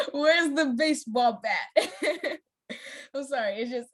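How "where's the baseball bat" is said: slow words broken by giggles; can't keep a straight face; real giggles breaking through the line; laughing. Real, not acted. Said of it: "I'm laughing at the word baseball bat".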